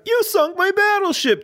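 high, sing-song board game whine; catchphrase voice. Retold or imitated. Cohost doing the board game catchphrase in a high sing-song whine. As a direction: say it like the sore loser in the ad. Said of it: "did somebody do the catchphrase".

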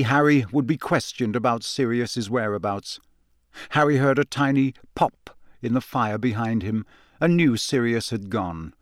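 ordinary delivery

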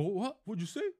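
high, loud, incredulous retort; mock confrontational disbelief. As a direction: act out a guy challenging an insult. Acted challenge in a retold fight.

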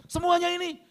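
very high, loud acted question; pressing apostle. Apostle presses wife about the offering, high, loud questioning.

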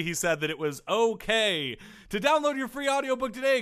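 bright, high-pitched sponsor read; upbeat announcer selling the ad. Acted. Reads sponsor ad.

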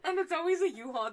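real laughter through the words; laughing tease. Real, not acted. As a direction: crack up while teasing. Teasing amid shared laughter.

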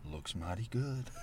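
soft imitation of an old Southern accent; quietly drawled old Southern accent; understated old-time Southern accent, imitating. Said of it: "she has an old Southern accent".

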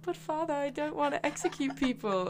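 high, soft pleading son voice; reluctant son. Acted. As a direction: voice a son objecting to his father. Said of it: "the son was like".

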